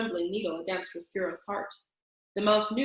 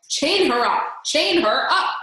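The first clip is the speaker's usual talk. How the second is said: higher, louder barked command; shouted order; 6 semitones higher, 9 dB louder than usual. A narrator's reading. Roscuro orders Mig chained, shouting. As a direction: shout it at the rat guards. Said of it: "shouted Roscuro".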